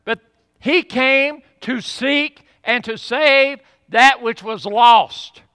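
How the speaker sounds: loud and high, slowing on the last words; impassioned preaching. Acted. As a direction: drive the point home from the pulpit. Climax of the sermon.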